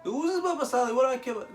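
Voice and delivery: high, loud mock indignation; scornful outburst. Offended guest dismissing the rabbi to diners, scornful outburst. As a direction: act out an offended big shot.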